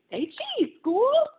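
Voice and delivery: put-on high, loud child voice calling say cheese; a delighted kid snapping pictures; excited little girl. Ruthie photographs everything with her tiny camera, high and gleeful.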